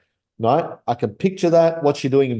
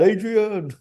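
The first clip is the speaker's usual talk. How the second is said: mild mock yell of the name, much higher; Rocky yelling; 9 semitones higher than usual. Imitating the boxer calling his girlfriend, mild mock yell. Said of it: "Rocky Balboa screaming out".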